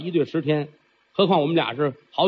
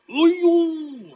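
high hammed-up cry; monster's high hammed-up wail; mock wail; 11 semitones higher than usual. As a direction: overplay the grief. Monster cries out in grief.

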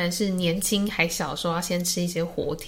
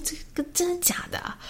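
mock disbelief; 11 semitones higher, 6 dB quieter than usual. Retold disbelief at brother's news.

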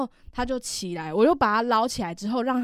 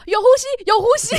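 higher, louder, fast, laughing; excited relief; 12 semitones higher, 8 dB louder, faster than usual. Retold or imitated. She finds the fainted old man breathing, excited laughing relief.

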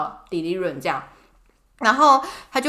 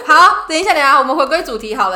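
laughing it off; 5 semitones higher, 9 dB louder than usual. Real, not acted. Laughing while trying to refocus.

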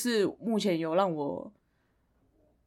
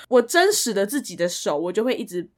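ordinary delivery

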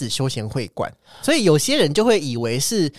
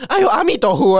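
put-on startled old uncle, higher and louder; old man's shocked exclamation; 6 semitones higher, 6 dB louder than usual. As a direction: gasp it out like a shocked old man. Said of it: "然后他就说".

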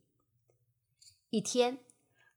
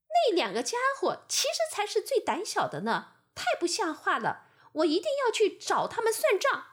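angry red ox; 8 semitones higher, faster than usual. A narrator's reading. Red ox rages about his brothers, higher and fast. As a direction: fire it off ready to fight. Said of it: "红牛听到这话非常生气".

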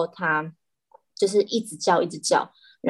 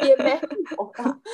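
laughing; 5 semitones higher than usual. Real, not acted. Laughing denial.

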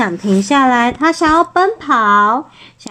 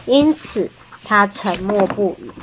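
ordinary delivery, nothing marked

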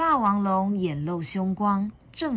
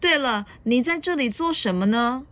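lightly voiced dinosaur, curious question; raised-pitch storybook question; Tyrannosaurus asking; 5 semitones higher, 4 dB louder than usual. A narrator's reading. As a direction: ask as the big dinosaur, a little lifted. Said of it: "霸王龙问".

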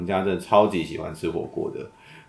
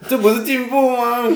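indignant surprise; 4 dB louder than usual. Real, not acted. Defending her family's hotpot.

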